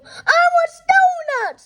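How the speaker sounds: very high, loud tantrum scream; boy screaming at mom in shop; screaming demand. Acted. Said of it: "screamed Henry".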